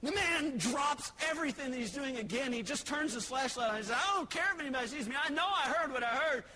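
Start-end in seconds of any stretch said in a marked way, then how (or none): 4.0-6.4 high, fast, insistent burglar; agitated outburst. Acted. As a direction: blurt it out in mock exasperation. Burglar blurts out in alarm.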